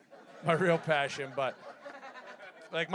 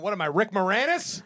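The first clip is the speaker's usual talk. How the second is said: punchline exclaim; 5 semitones higher, 7 dB louder than usual. Retold or imitated. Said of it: "I'm sitting there going".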